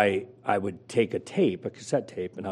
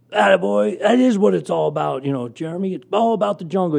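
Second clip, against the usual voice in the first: much higher, louder radio-host hype; Jim Rome voice; 10 semitones higher, 7 dB louder than usual. Retold or imitated. Radio host praising a caller on air, loud and high. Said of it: "he's doing the Jim Rome voice".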